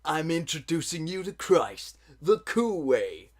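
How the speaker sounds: upbeat ad announcer voice; cheesy public service ad read; PSA announcer. Pretend.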